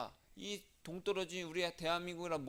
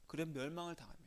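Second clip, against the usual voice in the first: hushed whisper; 7 dB quieter, faster than usual. Acted. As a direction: drop to a grave whisper. Solemn aside whispered.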